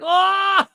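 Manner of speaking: loud high "oh" breaking into laughter; mock-dramatic cry, then a long laugh; theatrical wail. Parody of an overdramatic outburst.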